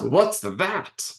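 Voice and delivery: high, startled clown question. Acted. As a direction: ask it like a jumpy clown. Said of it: "Rococo responds".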